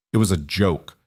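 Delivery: plain reading voice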